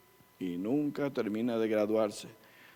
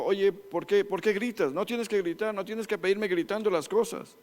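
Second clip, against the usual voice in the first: higher-pitched mock scolding of a child; scolding parent; 6 semitones higher than usual. Parent scolding a child for shouting, higher mock scold. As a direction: play the exasperated parent asking why he yells.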